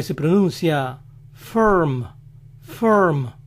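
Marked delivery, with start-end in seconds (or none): 1.3-3.3 "firm" as "frrm", no I sound; American "firm", R carrying the syllable; English vowelless R. Shown as a demonstration. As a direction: say "firm" as "ferm", tongue on the R. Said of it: "No se pronuncia la vocal I".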